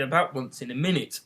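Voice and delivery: ordinary delivery, nothing marked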